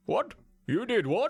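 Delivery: a hammed-up shocked city dweller; shocked Polynesian. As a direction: play the outraged islander. Polynesian reacting to Lara.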